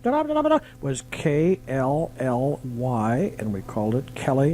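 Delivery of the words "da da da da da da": high-pitched da-da-da fanfare; mock fanfare. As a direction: sing out a playful little announcement flourish. Mimics fanfare before the call letters.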